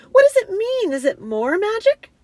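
high, loud bewildered questions; distressed cry. A narrator's reading. Susan baffled by the broken table, distressed cry. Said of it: "cried Susan".

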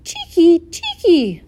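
high, loud swallow scold; scolding bird call. A narrator's reading. Swallows scolding a visitor to the barn, high call. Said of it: "they say".

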